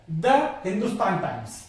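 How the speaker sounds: द said as a short "duh" before Hindustan. Shown as a demonstration. Teacher showing students द before a consonant sound. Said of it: "क्या बोला जाएगा इसको, टीएचई को".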